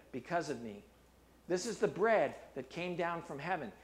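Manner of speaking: measured preaching read of Jesus' words; scripture reading. Acted. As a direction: read the passage with gravity.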